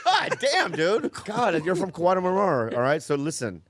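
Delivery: ordinary delivery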